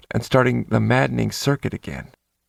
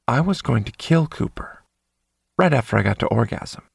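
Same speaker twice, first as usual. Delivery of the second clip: usual speaking voice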